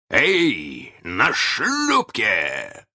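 очень протяжный зов издалека; растяни оклик над заливом; громовой оклик. A narrator's reading. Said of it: "снова прозвучало над заливом". (раскатистый крик капитана)

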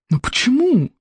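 high, baffled question; mildly acted bewilderment; dumbfounded. A narrator's reading. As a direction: ask it stunned, pitch jumping up. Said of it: "спросил обалдевший мракушник".

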